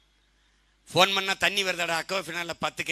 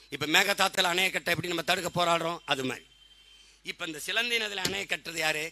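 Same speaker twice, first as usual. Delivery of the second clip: normal talking voice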